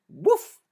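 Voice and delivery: high, loud bark; dog woof. A narrator's reading. Storyteller barking as the dog. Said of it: "said the dog".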